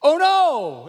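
high, loud, drawn-out oh no; hammed-up cry of dread; mock dismay. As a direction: wail it out in comic alarm. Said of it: "her return is this".

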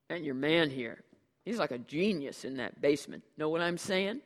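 loud, casual street-talk brother; breezy slacker. Acted. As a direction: brush it off, easygoing and loud.

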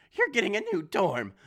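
high, loud motherly yell; mother yelling after the door shuts. A narrator's reading. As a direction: yell at your daughter. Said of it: "my mother yells".

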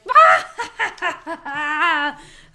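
loud, high laugh bursting out; big forced laugh. Retold or imitated. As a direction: laugh way too hard at a weak joke. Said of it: "everyone's like".

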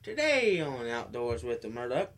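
loud announcer-style opener; show intro. Acted. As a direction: announce the segment like a host. Opens his show segment.

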